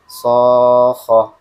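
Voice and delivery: SOKHOH with long level SO and a barely felt single KH; senza rialzo. Shown as a demonstration. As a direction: hold the note, no push into KHOH. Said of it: "Nggak berasa kan tasdidnya?".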